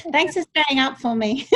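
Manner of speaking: thanks through laughter; laughing. Real, not acted. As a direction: say it while laughing.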